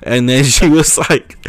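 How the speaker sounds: ordinary talk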